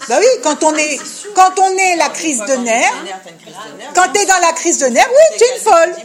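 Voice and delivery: heated insistence. Real, not acted. Teacher insists on the point.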